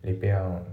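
"リペアアン" linked, with the D of and silent; English "repair-an", run together; dropped D. Shown as a demonstration. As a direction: drop the D in "リペアアン". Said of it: "ほぼ発音されてない".

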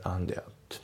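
"アンデアット" as and it joined, an-de-it; linked words. Shown as a demonstration. Teacher showing learners linking in and it, joined. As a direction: carry the D straight into it, "アンデアット". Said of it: "リンキングでアンデアット".

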